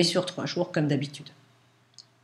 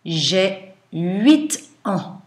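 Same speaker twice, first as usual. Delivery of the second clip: slow, louder dictation; dictating; 2 semitones higher, 5 dB louder, much slower than usual. Shown as a demonstration. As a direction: read it out slowly for the class.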